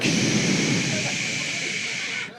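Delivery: breathy whispered sweet nothings; tender whisper. Acted. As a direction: hush her softly, lover's whisper. Tender hooker fantasy, whispering.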